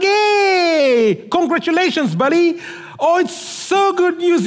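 excited greeting. Retold or imitated. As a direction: burst out with joy for a friend. Greeting friend, acted joyfully.

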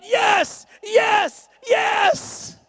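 high, loud rising yeses; crowd roaring. Retold or imitated. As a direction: roar it like a wild crowd. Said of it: "everybody roared".

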